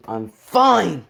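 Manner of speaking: FINE swelling loud and high; forceful insistence. Acted. Hero crushing everyone in the room, forceful insistence. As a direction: punch FINE with a burst of force.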